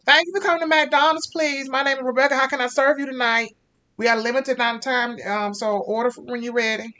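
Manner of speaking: high, loud, bright-voiced fast-food worker; over-eager drive-thru cashier greeting the car; chirpy drive-thru cashier. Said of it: "and you hear a".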